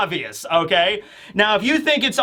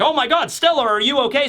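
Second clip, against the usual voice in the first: high-pitched, startled cry of worry; shocked concern; 8 semitones higher than usual. Retold or imitated. Shaka finding Stella's body in the prison, high shocked worry. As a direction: gasp it out like you just found her hurt. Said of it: "He's like".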